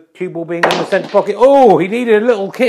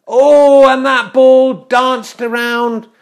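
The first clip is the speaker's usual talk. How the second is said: loud, high gasp of suspense stretched out slowly; drawn-out commentator's "oh", raised and loud; excited commentary; 6 semitones higher, 6 dB louder, much slower than usual. Pretend. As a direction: cry out as the shot nearly drops. Commentator reacts to near miss.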